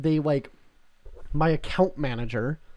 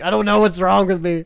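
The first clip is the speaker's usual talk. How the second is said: higher, louder self-mocking whine; helpless whine; 5 semitones higher, 8 dB louder than usual. Own self-mocking reply.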